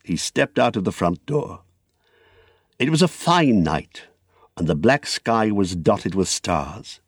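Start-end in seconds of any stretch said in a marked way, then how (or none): none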